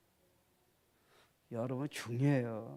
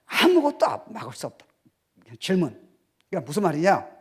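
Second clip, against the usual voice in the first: normal talking voice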